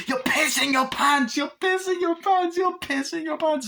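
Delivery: very high, put-on sing-song jeer; mocking taunt. Doll mocked for wetting himself, high jeering chant. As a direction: squeal the taunt over and over.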